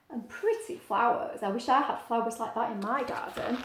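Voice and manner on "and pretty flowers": plain reading voice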